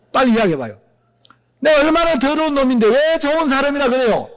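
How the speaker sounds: high, pushed exasperated outcry; exasperated protest. Acted. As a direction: demand why you're called good, voice up high.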